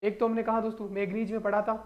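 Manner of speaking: ordinary talk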